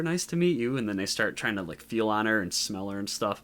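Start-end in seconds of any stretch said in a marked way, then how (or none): 0.0-0.8 high, chirpy mock greeting; sweetly polite, pitched up; friendly Supergirl. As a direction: imitate a naively friendly alien heroine. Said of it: "she's all friendly and stuff".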